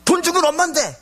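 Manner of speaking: put-on high, shouting child talking back; talking back loudly. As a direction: snap back at mom with a high yell. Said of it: "막 이렇게 소리를 지르는 거예요". (엄마에게 대드는 아이가 높게 소리치며)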